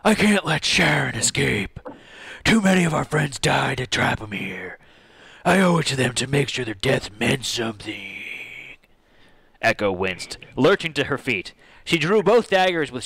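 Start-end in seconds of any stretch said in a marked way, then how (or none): 0.0-8.2 put-on heroic character voice; determined hero. Hero swearing duty to dead friends, determined character voice.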